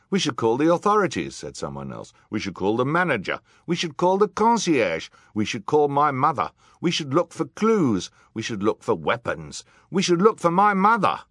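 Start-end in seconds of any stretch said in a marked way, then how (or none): 0.1-1.3 high, loud, fast demand; calling out. A narrator's reading. Crowd of guests calling out suggestions. 2.3-11.2 loud volley of crowd suggestions; calling out. A narrator's reading.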